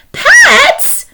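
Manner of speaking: a sudden high, loud, appalled "Pets?"; shocked outburst. Acted. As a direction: fling the word out in disbelief. Wife aghast at penguin pet idea.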